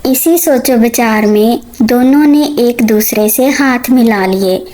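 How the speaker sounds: usual speaking voice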